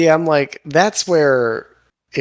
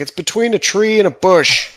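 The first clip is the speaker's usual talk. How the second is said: high, loud, exaggerated sing-out; hammed-up high-pitched quote; mock clue reading; 8 semitones higher, 6 dB louder than usual. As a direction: recite the silly clue with mock exasperation. Mocking the useless quest clue.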